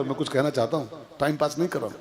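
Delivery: ordinary talk, nothing marked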